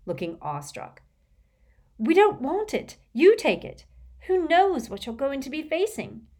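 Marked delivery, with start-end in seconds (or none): 2.0-6.3 put-on high, urgent young woman; Hermione, anxious. A narrator's reading. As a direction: plead with him, worried. Hermione pleads with Harry.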